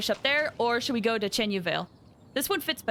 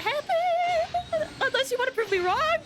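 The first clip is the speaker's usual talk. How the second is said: playful taunt; slower than usual. Real, not acted. Taunting the gacha.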